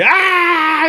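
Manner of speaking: high, loud mock scream; long drawn-out high cheer; imitated crowd roar. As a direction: scream back like a thrilled crowd.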